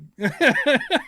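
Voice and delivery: high, loud giggle burst; quick high laugh; short laugh. Real, not acted. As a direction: chuckle brightly at a crude joke. Laughing at the funny song.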